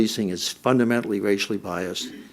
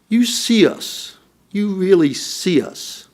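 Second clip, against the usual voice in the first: put-on high, slow Southern accent, heartfelt; 9 semitones higher, 5 dB louder, slower than usual. Sweet Alice telling the chief goodbye, slow Southern accent. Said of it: "in that delightful Southern accent".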